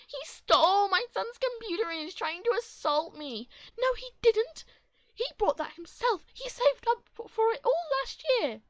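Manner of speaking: high, loud yelling back and forth; heated argument. Acted. Two mothers yelling over the computer. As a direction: keep shouting over each other. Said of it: "mothers arguing".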